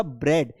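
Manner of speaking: "ब्रेड" with short e, silent a; bred for "ब्रेड"; correct pronunciation demo. Shown as a demonstration. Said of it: "sound क्या होगा इसका". ("ब्रेड" में "ए" की आवाज़)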